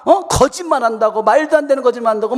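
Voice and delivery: high-pitched accusation; acted-out mom telling off; scolding mother. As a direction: accuse the child of lying. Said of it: "막 말을 해요".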